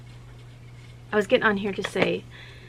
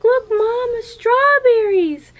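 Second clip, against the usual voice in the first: put-on much higher and louder, slow excited little girl; excited child; 10 dB louder, slower than usual. Little girl to her mama about strawberries, a high and loud excited squeal. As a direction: squeal it like a thrilled toddler. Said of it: "She was like".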